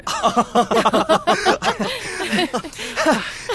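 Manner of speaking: bursting into a high gleeful laugh. Acted. Kid laughs gleefully about catching the lamp thief. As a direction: cackle with glee at the thief's coming downfall.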